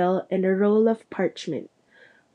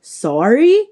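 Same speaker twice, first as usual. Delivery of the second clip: loud, rising bark of "sorry?"; barked question, swooping sharply up; barked disbelief; 4 semitones higher, 7 dB louder than usual. A narrator's reading. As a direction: fling the word back as an incredulous question. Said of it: "barked Hagrid".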